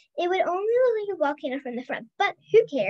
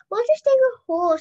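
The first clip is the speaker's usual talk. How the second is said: excited; 9 semitones higher, 7 dB louder than usual. A narrator's reading. Leo pipes up eagerly.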